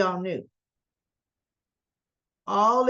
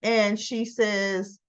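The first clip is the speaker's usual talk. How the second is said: normal talking voice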